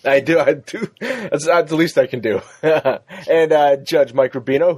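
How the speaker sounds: ordinary talk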